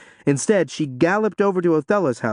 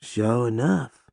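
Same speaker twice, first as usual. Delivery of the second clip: slow, lazy agreement; 2 semitones lower, 3 dB quieter, slower than usual. A narrator's reading. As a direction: drawl it out lazily. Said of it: "Simone agreed".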